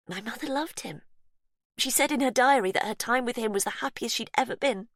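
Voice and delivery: high, unsettling sweet captor; Faith's voice. Acted. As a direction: coo to the victim, falsely kind. Faith explaining.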